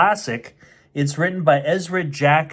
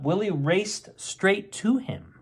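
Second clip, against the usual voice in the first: usual speaking voice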